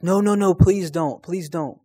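a timid man begging you to stop; frightened pleading. Retold or imitated. Homeless man begging not.

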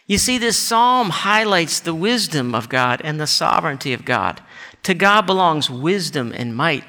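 usual speaking voice

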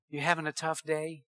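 soft, gentle question to defuse anger; low-key, hushed sympathy; quiet answer. Retold or imitated. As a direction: ask it very softly, imitating a patient customer. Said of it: "Quiet answer turns away wrath".

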